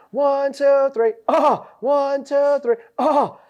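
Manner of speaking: high shouted count with a weird oh; mocking count shout. Shouting a count at struggling friend, high and loud. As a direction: yell the count to mess with a buddy. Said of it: "really weird noise".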